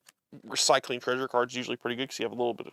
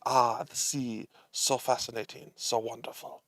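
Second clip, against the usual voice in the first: bad French accent; 3 semitones lower, 5 dB quieter than usual. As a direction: narrate dreamily in a hammy French accent. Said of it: "That was a bad accent".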